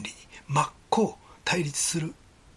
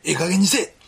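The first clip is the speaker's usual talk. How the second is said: louder fed-up snap at God; loud exasperated Osaka outburst; angry Osaka retort; 7 dB louder than usual. Retold or imitated. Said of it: "みたいな、そんな怒りを". (大阪弁で怒鳴りつける)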